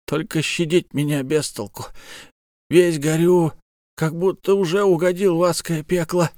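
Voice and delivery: pained, strained bandit voice; wounded bandit. Acted. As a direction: speak through fresh wounds. Dying bandit in pain.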